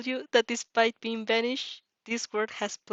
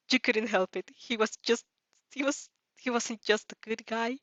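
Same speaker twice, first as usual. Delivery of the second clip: ordinary delivery, nothing marked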